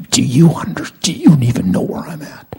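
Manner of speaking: high, loud, exasperated demand of God; desperate outburst. Retold or imitated. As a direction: break off and throw the question at heaven. Retold outburst climaxing prayer.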